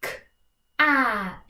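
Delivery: K-at with the a stretched; sounding out words. Shown as a demonstration. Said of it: "I'll show you what that looks like".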